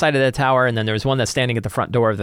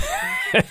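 laugh; 4 dB quieter than usual. Real, not acted. Burst of laughter.

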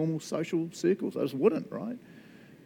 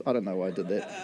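ordinary delivery

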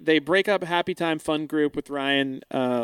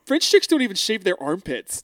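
raised, laugh-tinged quote of a goofy line; retold brash shout; 4 semitones higher than usual. Host quoting Luke's turkey-leg line to co-hosts, brash and amused. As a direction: call it out like a brash guy, amused.

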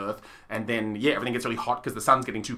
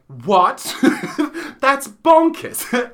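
Phaeton's shock; 10 semitones higher, 7 dB louder, much slower than usual. Retold or imitated. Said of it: "And then he's like".

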